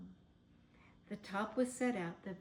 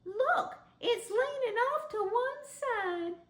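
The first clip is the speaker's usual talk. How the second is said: put-on high, slow child voices; children crying out; 14 semitones higher, 7 dB louder, slower than usual. A narrator's reading. The rabbit children cry out that the tree leans. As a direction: call out like little bunnies. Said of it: "the children cried".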